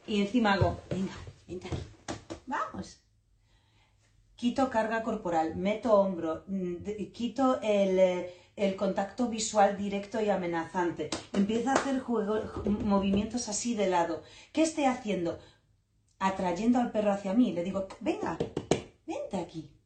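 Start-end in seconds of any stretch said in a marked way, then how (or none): 0.9-3.0 hushed, inviting call; soft coaxing call. Retold or imitated. Coaxing puppy to come closer. 18.0-19.7 soft coaxing call. Retold or imitated. Coaxing puppy to come closer.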